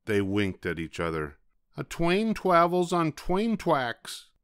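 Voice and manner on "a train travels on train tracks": slow, sad W for R; miserable. Acted. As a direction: answer slowly in misery. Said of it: "Wadney replied miserably".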